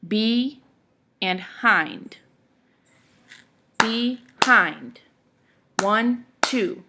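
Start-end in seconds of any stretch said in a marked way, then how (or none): 0.1-2.2 BE, then HIND, each said separately; two syllables with a clear gap between; syllables split apart. Shown as a demonstration. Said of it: "has two syllables in it". 3.8-5.0 "Behind" in two separate beats, be, hind; two evenly punched syllables in "Behind"; syllables split apart. Shown as a demonstration. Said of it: "two different sections to say the word".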